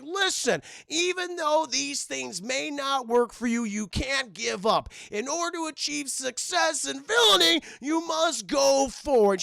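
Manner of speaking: lightly hammed motivational villain pitch; pompous villain speech. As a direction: ham up a villain recruiting speech. Max Zeus speech quoted.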